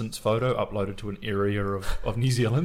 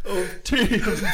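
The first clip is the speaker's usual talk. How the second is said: laughing; 8 semitones higher, 4 dB louder than usual. Real, not acted.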